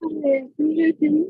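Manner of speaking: muffled, covered mic sound. Real, not acted. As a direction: speak as if through a hand over the mouth. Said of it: "It is very muffled".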